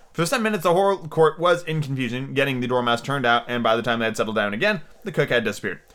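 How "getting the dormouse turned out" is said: normal talking voice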